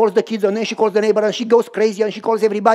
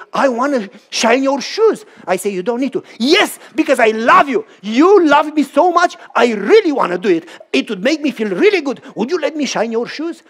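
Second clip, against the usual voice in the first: higher, louder, eager begging; eager pleading; 5 semitones higher, 4 dB louder than usual. Acted. Grateful man begging to shine shoes, eager louder plea. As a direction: plead to serve out of love.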